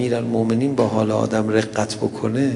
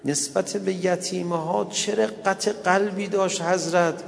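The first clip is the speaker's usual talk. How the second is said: higher-pitched, plaintive preacher's lament; mourning recital; 6 semitones higher than usual. Acted. Preacher mourns Fatima before the congregation, plaintive lament. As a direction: plead in grief, stretching the phrases.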